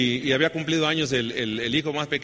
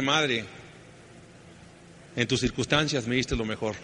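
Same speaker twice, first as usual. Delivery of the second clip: tender sincere; 4 semitones lower, 6 dB quieter than usual. Retold or imitated. Son embraces crying mother.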